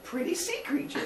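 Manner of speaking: high, soft dopey sibling voice; high silly voice. Retold or imitated. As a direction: sound sweetly clueless. Mock sibling reply.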